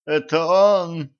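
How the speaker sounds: high, slow, mild moan. A narrator's reading. Dazed Elisei recognizes his enemy, moaning lightly. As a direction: groan it out, drawn and lifted. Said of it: "простонал Елисей".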